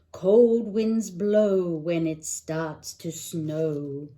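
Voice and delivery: lively picture-book read-aloud; singsong bedtime book voice; reading to children. Acted. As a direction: read it like storytime for a little one.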